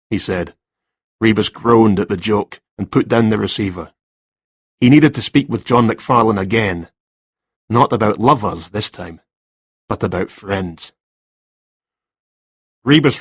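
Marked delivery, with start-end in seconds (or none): none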